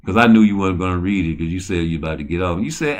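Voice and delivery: ordinary delivery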